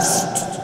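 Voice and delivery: whispered shh to calm her; Musa's whispered hushing. Acted. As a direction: hush someone gently. Musa hushing the widow.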